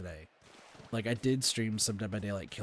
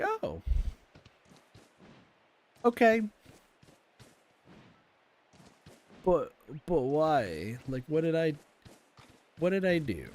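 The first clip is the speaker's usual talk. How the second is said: baffled hurt; 6 semitones higher, 4 dB louder than usual. Retold or imitated. Recalling teammate's blame.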